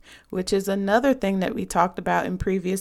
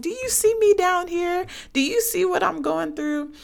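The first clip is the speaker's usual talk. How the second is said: frustrated plea; 9 semitones higher than usual. Retold or imitated. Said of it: "You're like".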